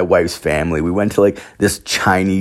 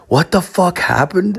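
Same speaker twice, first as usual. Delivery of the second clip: shocked question; 12 semitones higher than usual. Retold or imitated. Shocked question at family dinner.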